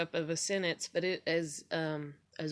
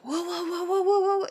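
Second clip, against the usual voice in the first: high, rising loud alarmed whoas; husband startled at the parking; panicked whoa; 12 semitones higher, 6 dB louder than usual. Retold or imitated. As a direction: imitate a startled husband yelping. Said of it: "And he's like".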